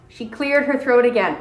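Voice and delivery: usual speaking voice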